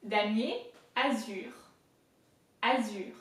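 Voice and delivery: "damier, azur" in careful French; "azur" with a light buzz on Z and heavier throaty R; French R emphasis. Shown as a demonstration. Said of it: "more heavily placed on the R than on the Z".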